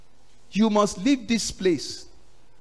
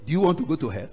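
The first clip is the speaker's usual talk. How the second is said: ordinary talk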